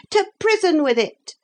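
very high outcry from a mob; crying crowd. A narrator's reading. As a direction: call for punishment in a high voice. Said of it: "cried the crowd".

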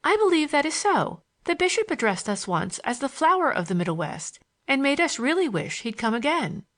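put-on high, girlish storytelling voice; lively schoolgirl.

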